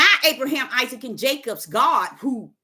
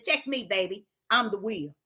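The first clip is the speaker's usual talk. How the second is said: voice of God; 6 semitones lower, 7 dB quieter than usual. Pretend. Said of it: "He said".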